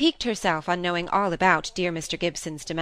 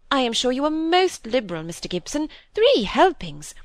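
impressed exclamation; 6 semitones higher, 4 dB louder than usual. Acted. Sister marvels at the helpings.

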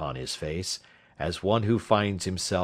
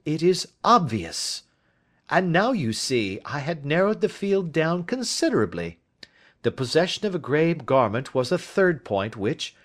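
a touch higher, brisk and assured; a confident detective laying out his case; lightly voiced detective; 9 semitones higher, 4 dB louder than usual. Detective asking his friend for advice, lightly voiced. Holmes narrowing the field.

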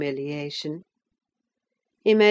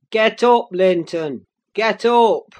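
loud, forceful command; barked, much louder order; shouted; 2 semitones higher, 8 dB louder than usual. A narrator's reading. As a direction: shout the order at the cowering boy. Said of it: "he shouted".

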